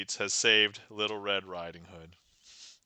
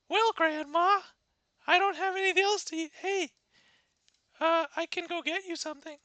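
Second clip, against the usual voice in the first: put-on high, fast little girl voice. Red telling grandma there is no more food, high quick girl voice. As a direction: babble it like a flustered little girl.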